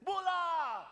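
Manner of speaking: high, bright shouted mbula; cheerful greeting. Retold or imitated. Fijians welcoming visitors, a high mbula shout. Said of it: "We'll say".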